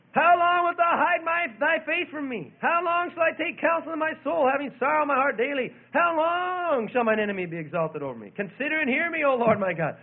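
loud, high crying out to God; David. Acted. David pleading with God over his enemy, crying out. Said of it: "Cried out, didn't he?".

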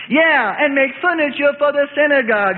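high, loud wisecrack; smart aleck shout. A narrator's reading. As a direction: holler the joke at him. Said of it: "another smart aleck shouts".

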